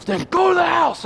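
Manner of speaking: high-pitched angry parental yell, acted out; retold scream. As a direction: mock-scream an order at your kid. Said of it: "And I'm screaming".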